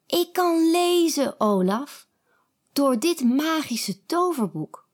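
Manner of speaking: high joyful announcement; thrilled girl. A narrator's reading. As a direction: tell him proudly. Kiki tells Olaf joyfully.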